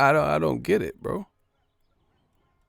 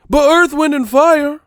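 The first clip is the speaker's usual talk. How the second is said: mock pundit listing; 10 semitones higher, 7 dB louder than usual. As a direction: list the names like a clueless pundit.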